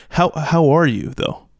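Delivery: "how are you" drawn out with weight; slow down and really ask "how are you"; slow, pointed question. Shown as a demonstration. Host showing co-hosts the question that makes you think, slow and pointed. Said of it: "But if someone's like".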